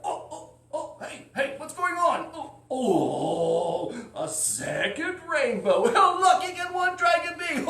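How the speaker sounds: high, loud, gleeful dragon voice; greedy dragon thrilled at his luck; excited dragon. Acted. Dragon wakes, sees rainbow.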